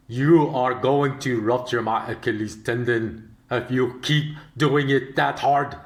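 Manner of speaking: put-on slow, deep West African accent; deliberate West African accent, slowed down. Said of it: "the West African accent, a really deep voice".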